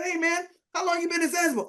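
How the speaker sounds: retold eager question, high and fast; quick, high-pitched curiosity; really excited. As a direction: quiz a friend in a high, excited rush. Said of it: "So I'm really excited".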